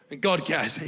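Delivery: usual speaking voice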